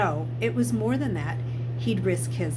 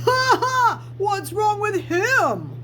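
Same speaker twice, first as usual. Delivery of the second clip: high, loud mocking laugh and a slow sneering question; cruel laugh; 13 semitones higher, 5 dB louder, slower than usual. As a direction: laugh down at the new kid, then sneer slowly. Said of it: "The boy laughed cruelly".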